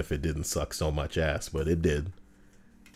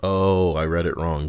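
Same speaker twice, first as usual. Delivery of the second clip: ordinary talk, nothing marked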